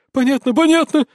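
high, panicked pleading; terrified. Acted. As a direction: cry it out in terror. Terrified bully begs for mercy.

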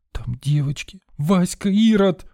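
high, rising wail of alarm; high-voiced cry. A narrator's reading. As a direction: cry out suddenly in distress. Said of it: "вскрикнула вдруг девочка высоким голосом".

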